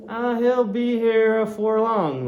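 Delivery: drawn up high with a knowing lilt. Acted. Landlord teasing his guest about the harpooner, high and loud.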